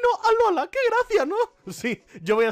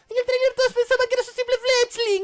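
higher put-on Pokémon taunt; mock captivating voice; 5 dB louder than usual. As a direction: jeer in a squeaky voice. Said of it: "Voz cautivadora".

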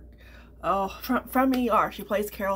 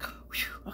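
soft unvoiced exhale of relief; relieved whew; 6 dB quieter than usual. Retelling a close call on the drive, breathy relieved whew. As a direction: blow out a mock sigh of relief.

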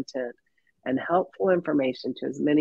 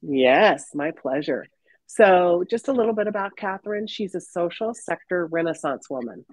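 ordinary delivery

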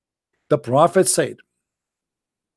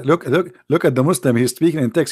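normal talking voice

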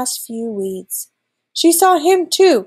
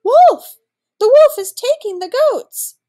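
high, loud shout of alarm; crying out; 14 semitones higher, 7 dB louder than usual. A narrator's reading. Boy calling villagers to the goats, crying out. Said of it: "the boy's cry came again".

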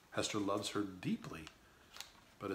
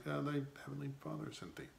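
unsure, hesitating, quick and softer; hesitant; 5 semitones higher, 4 dB quieter than usual. Shown as a demonstration. Hester answers daughter Pearl, hesitant and unsure. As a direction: say it as if doubting your own answer. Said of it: "she said it with a hesitation".

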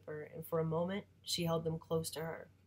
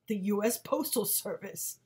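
louder scornful father voice; condescending; 3 semitones higher, 6 dB louder than usual. Acted. Father answering mom with scorn, condescending. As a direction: make her feel like a dope. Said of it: "that kind of voice that makes you feel like you're the dope".

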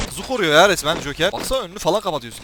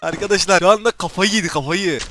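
ordinary delivery

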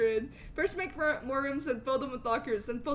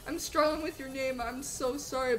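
ordinary delivery

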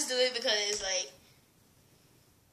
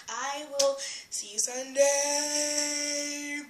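drawn-out goodbye; 3 semitones higher, much slower than usual. Real, not acted. Vlog sign-off.

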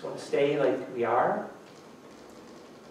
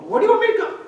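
mock squeaky question; 5 dB louder, much faster than usual. Mock dodging God's call.

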